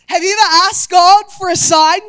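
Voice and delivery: loud preacher's question; loud preaching. Acted. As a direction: call it out to the whole room. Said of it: "repeated question to congregation".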